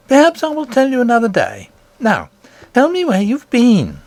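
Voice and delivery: high, bright-pitched worried nurse voice. Acted. Nurse scolding the princess for hiding, high voice.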